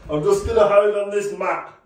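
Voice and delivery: high voice through real laughter; laughing. Real, not acted. Struggling with the dog by the bath, laughing helplessly. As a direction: say it while cracking up.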